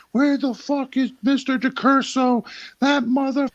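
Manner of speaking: very high mock yell for the teacher; mom yelling down the halls. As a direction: storm the halls hunting him. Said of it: "just cursing him out, going".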